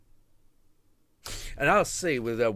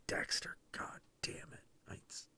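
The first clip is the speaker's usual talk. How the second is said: hushed disgusted whisper under the breath; 13 dB quieter than usual. Real, not acted. Whispering to himself about the diner, disgusted. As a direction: mutter it like a secret curse. Muttered aside in disbelief.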